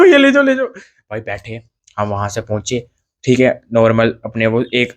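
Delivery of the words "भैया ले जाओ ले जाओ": eager yes. Retold or imitated. Eagerly accepting auto ride.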